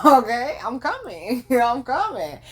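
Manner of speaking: giggling mock eager reply; laughing, pretending to be game. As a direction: chuckle through it, playing along.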